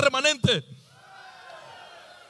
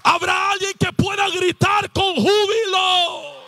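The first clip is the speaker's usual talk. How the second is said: high, slow, drawn-out shout; sustained, rousing altar cry; full-force preacher's appeal; 5 semitones higher, 4 dB louder than usual. Real, not acted. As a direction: call the congregation to shout.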